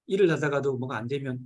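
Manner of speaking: ordinary talk, nothing marked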